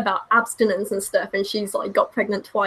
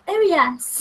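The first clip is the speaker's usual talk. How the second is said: plummy posh English "Oh, yes."; mock upper-class British "Oh, yes."; 5 semitones higher than usual. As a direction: put on a posh Oxford accent. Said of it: "I was m-, way more like".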